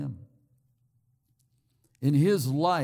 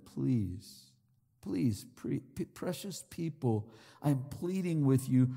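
soft, lowered plea; gentle pleading; 4 semitones lower, 6 dB quieter than usual. Acted. Preacher as Paul begging precious people, softly pleading. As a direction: plead softly and earnestly, halting over the words. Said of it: "No, he's pleading with them".

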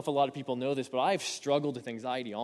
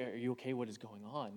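quietly asking a shaking husband if he is okay; a hushed, concerned wife's question; soft worried question; 3 semitones lower, 10 dB quieter than usual. Retold or imitated. Wife worried, waking up.

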